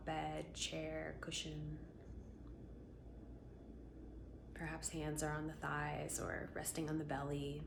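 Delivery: calm voice leading a breathing practice; guided meditation. Acted. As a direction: ease them gently into their body.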